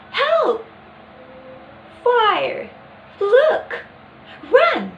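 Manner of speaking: high, loud calls for help; great fear. Shown as a demonstration. Teacher showing how one in great fear calls out, high-pitched fearful shouts. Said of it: "great fear may utter stress sound in a high pitch".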